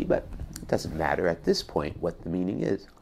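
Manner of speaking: ordinary talk, nothing marked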